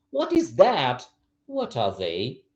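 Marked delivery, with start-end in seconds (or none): 0.1-1.1 THAT pitched high, then falling; emphasis on THAT. Shown as a demonstration. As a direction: point it out with a high THAT. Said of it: "changing intonation and changing a bit, uh, the emphasis".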